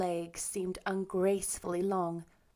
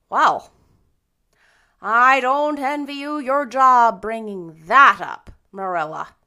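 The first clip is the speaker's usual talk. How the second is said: unspeakable solemnity; 3 semitones higher, 12 dB louder than usual. A narrator's reading. Rachel pities Marilla's job, very solemn. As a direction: drag it out with heavy solemnity. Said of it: "with unspeakable solemnity".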